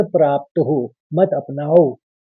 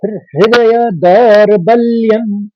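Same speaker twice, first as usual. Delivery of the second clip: slow, raised chanting; shloka recitation; 4 semitones higher, 4 dB louder, slower than usual. Shown as a demonstration. Teacher rebuilding the third line after splitting it, chanted. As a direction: chant the words out carefully. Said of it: "पहले शुद्ध कहेंगे".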